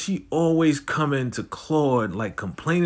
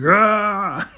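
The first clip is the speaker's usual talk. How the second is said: high hammed-up war yell; mock Rambo battle cry; 7 semitones higher, 3 dB louder than usual. Jannard charges out with a mock war cry. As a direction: yell like an action hero charging in. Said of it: "Like he's Rambo or something".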